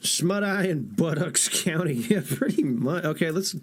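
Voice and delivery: ordinary talk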